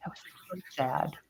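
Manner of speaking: breathy whisper, barely audible; hushed, grieving whisper; whispered sadness. Real, not acted. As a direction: let it fall to a sad whisper. Sighs over sad family memory.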